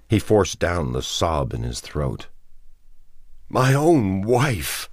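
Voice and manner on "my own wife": high, slow, anguished; trembling voice. A narrator's reading. Man speaks of his undead wife, trembling. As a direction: force it out slowly through grief. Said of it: "in a trembling voice".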